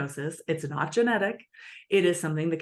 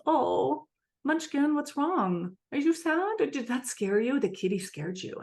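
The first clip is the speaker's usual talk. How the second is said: tender soothing parent; 9 semitones higher than usual. Retold or imitated. Parent comforting a child scared by the kitty, high tender coo. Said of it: "the parent goes".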